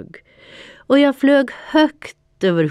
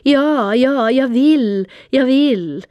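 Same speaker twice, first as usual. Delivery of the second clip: higher and louder, bursting out with yes; eager exclamation; 9 semitones higher, 6 dB louder than usual. A narrator's reading. The cat suddenly agreeing to Panter, eager exclamation. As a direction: blurt it out, carried away by excitement. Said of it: "hörde jag mig själv utropa".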